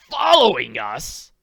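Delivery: loud, slow, astonished cry; shocked disbelief, loud; cried out. Acted. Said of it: "cried Ducroy".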